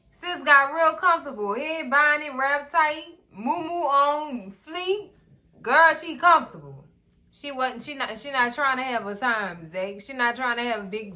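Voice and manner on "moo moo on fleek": ordinary talk, nothing marked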